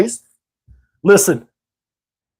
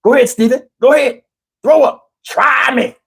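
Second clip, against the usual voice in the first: high, raised, daring taunt; taunting granddad; 6 semitones higher than usual. Retold or imitated. Granddad daring Steven to throw up, raised and taunting. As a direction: dare him to throw up. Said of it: "He's like".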